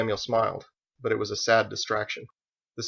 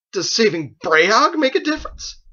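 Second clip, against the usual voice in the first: high, loud, pointed question; indignant challenge; 12 semitones higher, 9 dB louder than usual. Acted. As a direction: challenge him sharply. Joseph challenges his master.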